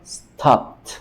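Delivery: stopped as one syllable, ending in a crisp T; -ed as plain T. Shown as a demonstration. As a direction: say "stopped" like "stopt", no extra vowel. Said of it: "esta P, pues, no suena".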